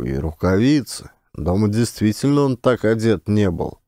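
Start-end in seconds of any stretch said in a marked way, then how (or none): none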